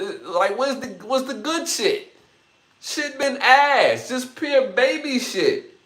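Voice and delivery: high, loud, exasperated ranting; heated complaint about the game, voice climbing high; worked-up rant. Real, not acted. Worked-up rant, much higher.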